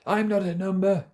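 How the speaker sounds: high-pitched theatrical declaration; acted protest. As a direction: cry it out high in mock protest. Quoted protest after number talk.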